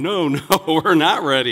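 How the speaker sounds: protesting adults. Acted. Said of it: "And then adults are going".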